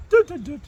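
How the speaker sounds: high "Doo, doo, doo" dropping at the end; falling electronic beep tune; dishwasher shutdown beep. Retold or imitated. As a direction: beep it like a machine powering down. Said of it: "And when you turn it off, it goes".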